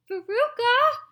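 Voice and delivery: high, loud shout down a hole. A narrator's reading. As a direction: call her daughter at full volume. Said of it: "shouted Missus Salt".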